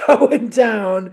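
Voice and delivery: going down laughed out and shouted; laughing shout as mom keeps sliding; laughing mock shout. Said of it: "And what does she say?".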